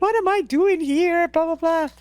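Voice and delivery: high whiny babble, imitated; mock a tearful meltdown; mock crying. Climber suddenly realizing what she's doing, mock crying. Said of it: "I'm like, I'm crying".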